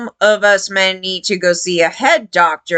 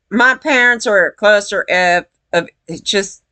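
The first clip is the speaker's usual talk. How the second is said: ordinary talk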